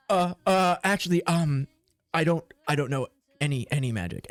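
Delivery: halting, stammered excuse; stammering. Acted. Player's character put on the spot about magic, stammering. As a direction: stammer and hedge, feeling for words. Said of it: "you start stammering".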